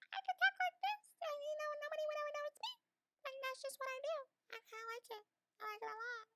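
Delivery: tiny, very high squeaky voice; changed voice. Shown as a demonstration. Podcaster showing off voice changes, tiny squeaky high voice. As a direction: squeak it out softly, sky-high. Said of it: "my love of, like, voice changes".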